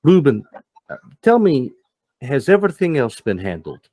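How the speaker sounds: sudden sharp turn to Ruben; Max's question. Acted. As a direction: Max checking with Ruben after his phone, high and loud. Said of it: "He looks back and says".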